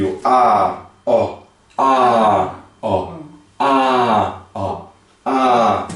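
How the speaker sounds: long open "A" against short throaty "ó"; alternating vowels. Shown as a demonstration. Teacher drilling two vowels, long "A" then short "ó". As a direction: swing between held "A" and brief "ó". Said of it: "um pouco mais profundo na garganta".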